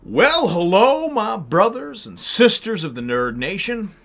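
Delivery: high, loud, slow host welcome; showman greeting. Acted. Show host greeting viewers.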